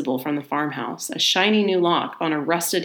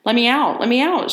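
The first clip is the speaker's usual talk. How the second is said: higher, louder pleading yell; desperate shout; 7 semitones higher, 5 dB louder than usual. Acted. Johnson, locked in the barn, shouting at the door. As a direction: pound the door and yell to be freed. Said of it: "shouted Johnson".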